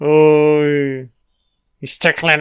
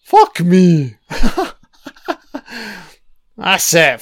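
usual speaking voice

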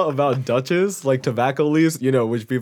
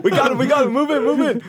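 mock police raid; 11 semitones higher than usual. Playing cops busting in.

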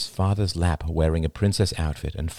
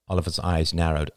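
ordinary delivery

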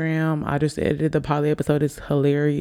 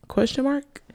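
sarcastic aside; 10 semitones higher, 3 dB quieter than usual. Acted. Joking aside about date.

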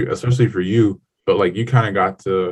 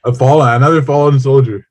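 higher, louder mock solemn report of a fallen soldier; 6 semitones higher, 7 dB louder than usual. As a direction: announce it like a soldier just went down. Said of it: "Another fallen soldier voice".

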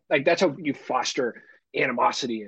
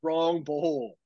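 mock exasperated kid; 4 semitones higher, 5 dB louder, much slower than usual. Skit of child correcting drunken dad.

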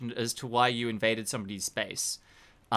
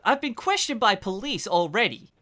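high-pitched, flustered protest; indignant suspect; 10 semitones higher than usual. Acted. Suspect protesting to the investigators questioning him, high-pitched and flustered. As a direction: stammer through it in a high voice.

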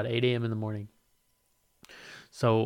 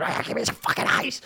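mock outburst; 11 semitones higher than usual. Mock complaint about warm water.

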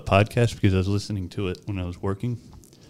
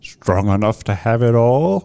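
movie quote voice; 5 dB louder, slower than usual. Retold or imitated. Quoting the movie line.

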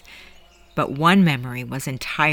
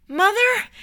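very high, desperate call; high-pitched cry to someone slipping away. Acted. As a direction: call after her, pitched way up. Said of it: "he called out".

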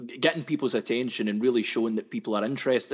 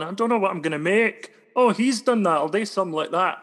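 much higher, louder dopey mock voice; clueless copycat comedian; 8 semitones higher, 6 dB louder than usual. Comedian mocking the idea of soaking up influences, higher, louder dopey voice. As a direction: play a witless copycat lifting other people's jokes. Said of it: "like a sponge going".

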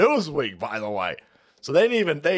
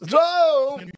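calling out; 11 semitones higher, 4 dB louder than usual. Real, not acted. Calling out to friend Jones.

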